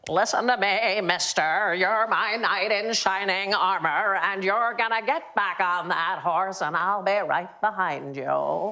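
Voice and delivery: put-on drawn-out, slow old Hollywood Mid-Atlantic voice; Katharine Hepburn. Shown as a demonstration. Performing a party-piece impression for the panel, slow Mid-Atlantic film-star voice. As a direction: put on a stretched-out classic film-star accent. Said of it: "like to hear my Katharine Hepburn".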